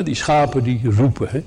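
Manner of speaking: ordinary talk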